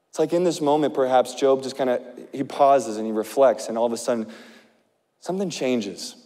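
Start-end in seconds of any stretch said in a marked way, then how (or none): none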